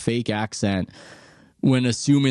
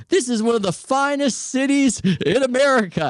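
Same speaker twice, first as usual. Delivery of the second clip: high, hammed-up gushing announcer voice; imitating McAfee; 13 semitones higher than usual. Host at Berkeley game day, gushing mock praise. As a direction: gush like a TV host praising the city. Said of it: "he's going".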